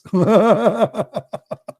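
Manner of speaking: high, staccato cackling laugh; long laugh. Real, not acted. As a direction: cackle in a rapid high string, trailing away. Mock mystery boast, long laugh.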